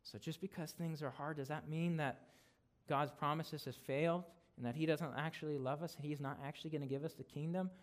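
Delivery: ordinary talk, nothing marked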